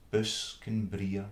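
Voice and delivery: ordinary delivery, nothing marked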